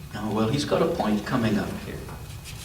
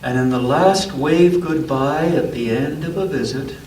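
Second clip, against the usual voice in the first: gentle storybook read-aloud; unhurried picture-book narration; reading to a child; 5 dB louder than usual. A narrator's reading.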